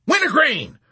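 high, loud cry into the phone; panicked general's shout; frantic call. A narrator's reading. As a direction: yell the name desperately.

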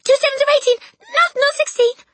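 high, loud panicked yell; Rupert crying out. A narrator's reading. Boy answering the menacing headmistress, high loud cry. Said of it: "he cried".

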